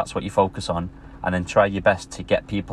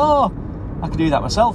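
mock scoffing barber; 10 semitones higher than usual. Barber laughing off the plasterer's quote, high scoffing voice. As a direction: imitate someone laughing off a price. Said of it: "the barber starts laughing, he's like".

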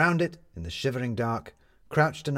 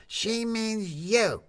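drawn-out, higher, spelling it out; exasperated; 12 semitones higher, much slower than usual. A narrator's reading. Tin Lizzie spelling it out to Jefferson, slow and exasperated. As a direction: say it as if to a child. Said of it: "couldn't stand it any more".

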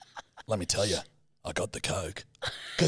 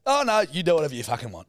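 mock huffy, raised and quick; fast, pitched-up retold huff; salty dad; 5 semitones higher, 4 dB louder, faster than usual. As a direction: imitate a dad in a loud sulk. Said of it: "he gets really salty with me".

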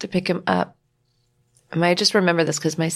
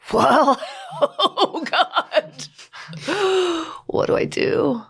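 laughing disbelief; 10 semitones higher, much slower than usual. Real, not acted. Retelling absurd shock, laughs.

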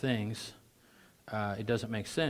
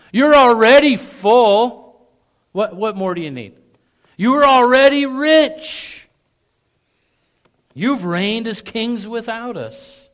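high, loud, slow mocking praise; over-the-top sarcastic admiration; best sarcasm; 13 semitones higher, 18 dB louder, slower than usual. Shown as a demonstration. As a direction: drip with sarcasm. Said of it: "I'll give you my best sarcasm".

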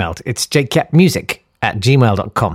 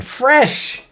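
mock comedian; 10 semitones higher, much slower than usual. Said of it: "observational comedy about ikea".

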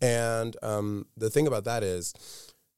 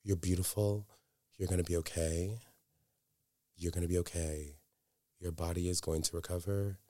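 softer, tender reassuring murmur; soothing reassurance; 3 semitones lower, 7 dB quieter than usual. Retold or imitated. Comforting the comatose girl by rubbing her forehead, soft and tender. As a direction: gently comfort someone unconscious. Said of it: "I was saying beautiful things".

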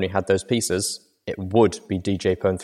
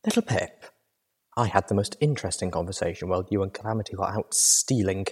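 gentle-volume companion voice; soft-spoken Velvet Remedy; 6 dB quieter than usual. Acted. Velvet raises a new topic with tired Little Pip, soft-spoken. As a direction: speak softly, politely pointed.

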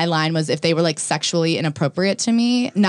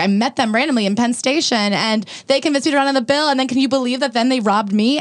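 higher, put-upon retelling of her excuse; mock indignant woman; 6 semitones higher than usual. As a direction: imitate her wide-eyed outrage. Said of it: "she was like".

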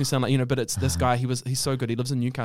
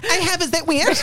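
high, loud playful question; high laughing camp voice; 13 semitones higher, 5 dB louder than usual. Retold or imitated. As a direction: squeak it out with a laugh. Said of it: "I'm like".

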